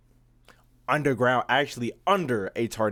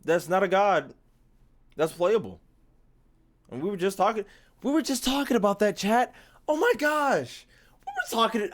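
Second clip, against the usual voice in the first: high-pitched, rapid hype about the new character; excited; 10 semitones higher, 4 dB louder than usual. Real, not acted. Streamer to chat about visionless character, excited high voice.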